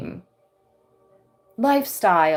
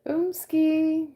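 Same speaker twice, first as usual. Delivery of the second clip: playful exclamation; 11 semitones higher than usual. Real, not acted.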